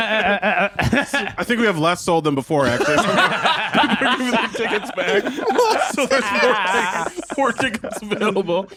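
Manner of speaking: high-pitched, slow, laughing through the words. Real, not acted. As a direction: crack up while getting the words out. Joking about poor ticket sales.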